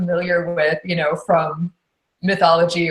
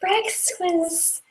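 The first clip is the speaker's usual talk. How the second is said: much higher, creaturely kobold voice; 12 semitones higher, slower than usual. Shown as a demonstration. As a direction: squeak it out like a little kobold. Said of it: "how a kobold was".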